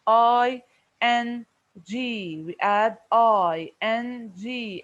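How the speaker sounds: letters spelled slowly and clearly; slow spelling out. Shown as a demonstration. Teacher spelling the suffix slowly for pupils, slow and clear. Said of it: "spells suffix slowly for class".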